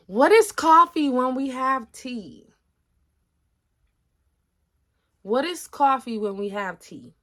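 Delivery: drawn-out hammed-up tea sermon; mock outrage. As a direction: proclaim it like a grand tea zealot. Mock tea-lover rant, repeated.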